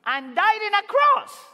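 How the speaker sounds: rising, forceful exclamation; emphatic. Real, not acted. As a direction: hit the cross hard. Climax of sermon point.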